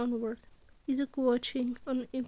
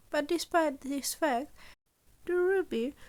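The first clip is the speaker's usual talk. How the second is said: usual speaking voice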